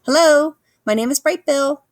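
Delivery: high, loud little gosling; proud little gosling introducing himself. A narrator's reading. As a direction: chirp it out like an eager baby goose. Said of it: "waddled outside".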